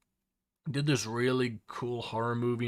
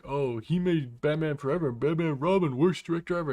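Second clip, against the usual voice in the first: mocking critic voice; 4 semitones higher, 5 dB louder than usual.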